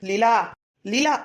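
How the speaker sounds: calling out after her. Acted. Calling after leaving woman.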